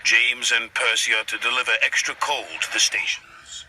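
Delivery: loud, commanding controller; stern boss voice. A narrator's reading. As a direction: give the order loudly like a boss. Sir Topham Hatt giving orders.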